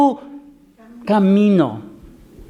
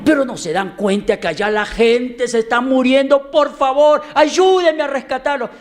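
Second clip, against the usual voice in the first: desperate plea; 7 semitones higher than usual. Acted. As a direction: beg the congregation for help. Young man pleads again. (súplica desesperada, aguda y fuerte)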